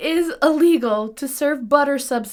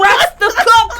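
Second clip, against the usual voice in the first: mock indignant shout; 9 semitones higher, 9 dB louder than usual. Louder repeat, mock outrage.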